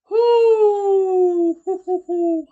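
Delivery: shaky woo. Real, not acted. Shaken by the scary story.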